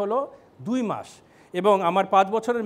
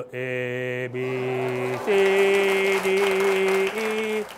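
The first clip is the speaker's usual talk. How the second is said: slow, droning letters with long gaps; mock child's halting singsong; child reciting; much slower than usual. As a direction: recite the alphabet like a reluctant child, dragging each letter. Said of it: "বাচ্চা শুরু করলো".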